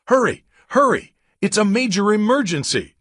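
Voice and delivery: high, loud panicked scream. Acted. Caller screaming down the phone for help, in a loud high panic. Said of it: "someone screamed on the other end".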